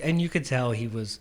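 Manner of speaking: ordinary delivery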